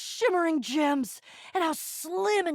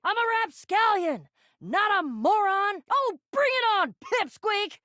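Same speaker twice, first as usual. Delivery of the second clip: high-pitched, blustering retorts; indignant squabbling; 7 semitones higher than usual. Acted. Trading insults with a tiny pirate, indignant. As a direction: puff up and taunt, pitched high.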